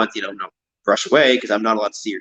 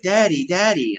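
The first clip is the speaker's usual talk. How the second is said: put-on child calling; 7 semitones higher, slower than usual. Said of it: "just like".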